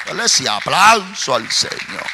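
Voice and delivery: slow, loud, high preaching cry; fervent praise shout. Real, not acted. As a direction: drag out each praise as a roaring shout. Preacher calls praise, full force.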